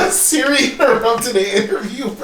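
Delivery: high, loud words through real laughter; laughing hard. Real, not acted. Host to guest as Siri interrupts, cracking up. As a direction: barely get it out for laughing.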